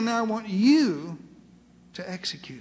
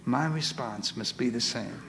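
solemn poem recitation. Acted. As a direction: let each line settle, easing down at the end. Poem closes in slow surrender.

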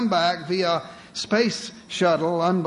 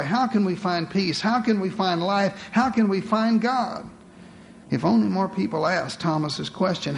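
normal talking voice

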